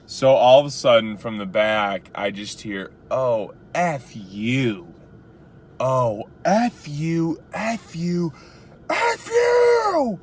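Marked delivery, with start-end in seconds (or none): 3.1-10.2 a slow build of curses up to a high shriek; screaming and cussing. Retold or imitated. Drunk passenger cursing the driver from the back seat, building to a shriek. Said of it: "she starts, like, screaming".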